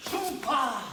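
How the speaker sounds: loud high battle cry; kiai shout. Real, not acted. Instructor demonstrating a sweep to the class, kiai shout. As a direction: shout it as you throw.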